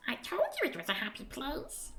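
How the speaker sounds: high squeaky told-you-so; a cheeky sprite gloating; smug tiny creature. Acted. Creature remarks smugly.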